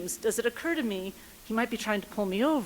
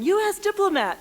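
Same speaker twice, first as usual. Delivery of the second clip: high, pitched-up yell of her status; 10 semitones higher than usual. Retold or imitated. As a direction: cry it out to stop him. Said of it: "and I start yelling".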